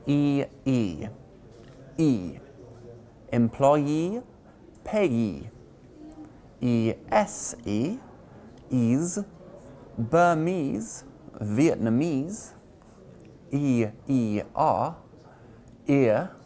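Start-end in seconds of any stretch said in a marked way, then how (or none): 3.3-5.5 Employee and payee with the final ee stressed; stressed ending. Shown as a demonstration. Teacher showing the ee ending, stress on ee. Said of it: "which you generally stress". 10.0-12.5 Burmese and Vietnamese stressed on the final ese; stressed ending. Shown as a demonstration. Teacher showing the ese ending, stress on ese. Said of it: "which you generally stress".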